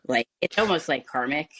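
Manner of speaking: normal talking voice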